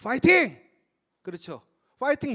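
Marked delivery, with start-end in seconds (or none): 0.0-0.6 loud, higher-pitched fighting cheer; short burst of cheering; team cheer. Shown as a demonstration. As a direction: shout it like rallying a team before a game. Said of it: "게임할 때 뭐라고 그래요".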